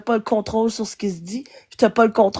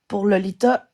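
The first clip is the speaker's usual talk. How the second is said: usual speaking voice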